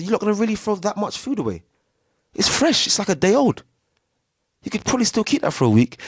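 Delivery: high, fast incredulous protest; can't believe they'd waste it; indignant disbelief. Retold or imitated. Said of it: "I said".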